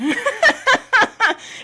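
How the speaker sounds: high, loud burst of laughter. Real, not acted. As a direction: crack up at yourself. Laughs at her own dramatic brain.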